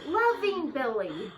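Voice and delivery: slow, loud, sing-song correction; sweet cover-up. Pretend. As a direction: say it sugary to cover the curse. Suggesting a cover-up phrase.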